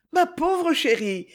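high, slow, trembling comfort; upset lady soothing a hurt girl. A narrator's reading. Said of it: "dit Isabelle d'une voix tremblante".

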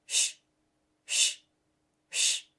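soft shushing. A narrator's reading. Imitated rustling sound.

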